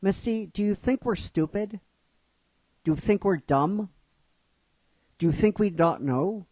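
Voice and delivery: sister confronting Misty. Retold or imitated. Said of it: "one sister says to her".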